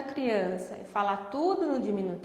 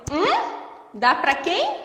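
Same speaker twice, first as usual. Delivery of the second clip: high, louder feigned puzzlement; pointed mock confusion; 7 semitones higher, 5 dB louder, slower than usual. Therapist pretends not to understand the mother, high and louder. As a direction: play dumb to correct the mother. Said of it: "Chamando a atenção da mãe".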